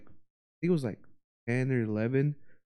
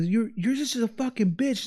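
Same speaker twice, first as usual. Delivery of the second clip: high mocking impression of an insult; mock angry man; 9 semitones higher than usual. Imitating the neighbor guy insulting her, high and mocking. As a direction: toss the insult like a cartoon of him.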